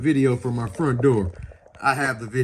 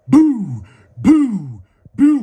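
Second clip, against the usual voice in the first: imitated banging; 6 semitones higher, 7 dB louder, much slower than usual. Window being beaten in.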